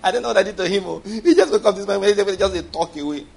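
high-pitched, laughing mock phone whine; complaining wife. Wife calling her mother about her husband, mock complaining. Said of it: "Start calling their mother".

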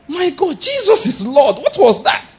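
high-pitched startled outcry; shocked exclamation. Shown as a demonstration. Preacher demonstrates his own substitute swear words, acted shock. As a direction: cry out in alarm, acting shocked. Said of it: "that's one of the ways I express my shock".

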